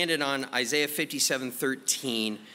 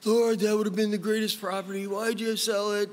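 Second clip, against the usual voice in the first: whining to God; 6 semitones higher than usual. Retold or imitated. Pastor mimicking his summer complaints to God, whiny. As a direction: whine it out like a sulking kid. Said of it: "I had been whining so much".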